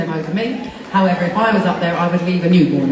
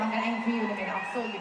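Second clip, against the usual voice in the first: ordinary talk, nothing marked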